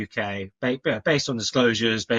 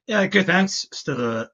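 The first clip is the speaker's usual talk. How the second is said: croaky with a cold; hoarse from man flu; 10 semitones higher, 5 dB louder, slower than usual. Real, not acted. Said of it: "forgive the voice, still a bit man flu-y".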